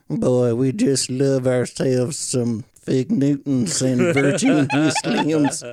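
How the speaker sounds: put-on slow, whistly old Southern drawl; Southern accent. Shown as a demonstration. A Southern accent showing how the old man talks, slow and whistly. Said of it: "he likes to talk like this".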